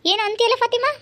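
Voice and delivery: mother-in-law challenging the neighbour, high and loud; shrill mother-in-law. Acted. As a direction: pick a fight in a high, loud voice.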